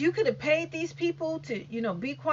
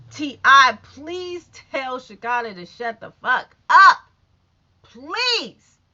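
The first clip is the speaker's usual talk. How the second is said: loud call out; 9 dB louder, slower than usual. Real, not acted. Calling out to T I.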